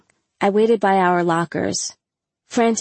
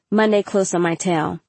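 ordinary delivery

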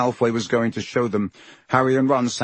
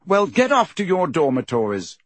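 stern order; 6 semitones higher than usual. A narrator's reading. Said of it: "said Percy sternly".

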